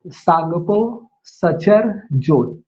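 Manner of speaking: very drawn out, one word at a time; slow dictation. Shown as a demonstration. Teacher repeating the term a final time for notes, slow. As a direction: say it one last time, slowly. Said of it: "मैं फिर से एक बार बोल रहा हूँ last time".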